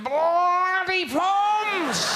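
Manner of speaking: very high, drawn-out mock Aussie shout; stretched, shouted jeer; Aussie fan yelling. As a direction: bellow it like an outraged fan. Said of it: "they'll be going".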